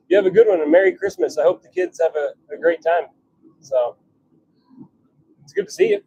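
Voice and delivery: ordinary delivery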